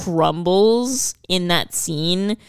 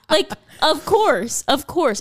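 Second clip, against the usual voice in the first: high, slow manic cartoon glee; lightly voiced cartoon character delight; vintage cartoon voice; 9 semitones higher, slower than usual. Imitating Bateman's cartoonish delight.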